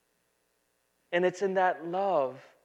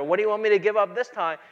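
fast, louder mock complaint; tired churchgoer griping, fast and louder; exasperated grumble; 3 semitones higher, 7 dB louder, faster than usual. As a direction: rattle it off like a fed-up believer.

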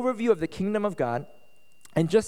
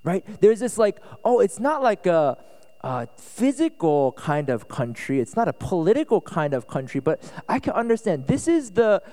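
louder, energetic sermon delivery; animated preaching; 2 semitones higher, 5 dB louder than usual. Acted. Pastor explaining Longhorn country to his congregation, animated. Lively preaching at raised volume.

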